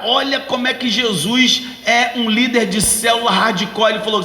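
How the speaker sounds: ordinary talk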